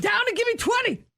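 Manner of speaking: barking army command. Acted. Drill sergeant bit.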